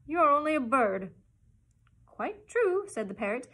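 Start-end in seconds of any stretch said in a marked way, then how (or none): 0.1-1.1 tearful pig voice. A narrator's reading. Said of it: "began to cry again". 2.1-2.9 parrot voice. A narrator's reading. Parrot answers the pig.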